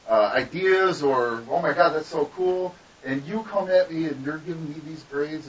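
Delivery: telling her off. Retold or imitated. Student to intern teacher who graded them, telling her off. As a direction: lay out the grievance with rising indignation. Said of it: "did I tell her off".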